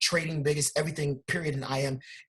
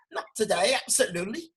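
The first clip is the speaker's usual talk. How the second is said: mock London accent; 4 semitones higher than usual. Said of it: "London accent".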